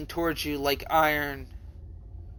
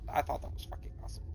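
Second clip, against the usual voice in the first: whispered aside; 2 semitones lower, 12 dB quieter, much faster than usual. Real, not acted. Quiet aside while reading story.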